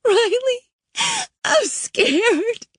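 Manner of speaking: very high, tearful plea; crying out for her mother; weeping, scared. Acted. Girl weeping to Riley on the phone. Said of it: "She started to weep".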